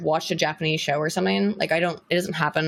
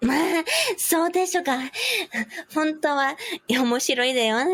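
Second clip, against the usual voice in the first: high cutesy anime streamer voice; VTuber voice; 9 semitones higher than usual. Pretend. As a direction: play a bubbly VTuber. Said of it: "bright future as a VTuber".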